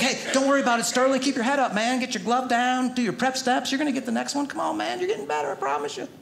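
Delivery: high, gentle encouraging coach; high sugary coach to other kids; sweet coach voice. Retold or imitated. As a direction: coax someone else's kid kindly.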